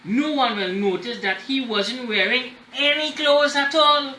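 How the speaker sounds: usual speaking voice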